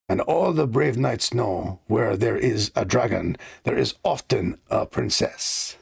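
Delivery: put-on butchered French accent; storytelling in a put-on French accent; Lumiere. The candelabrum telling of a knight and dragon, butchered French accent. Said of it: "I'm gonna butcher this French accent".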